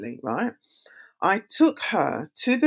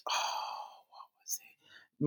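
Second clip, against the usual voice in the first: whisper to self; 15 dB quieter, much slower than usual. Real, not acted. Searching memory, muttering to self.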